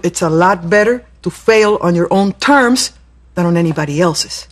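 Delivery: ordinary talk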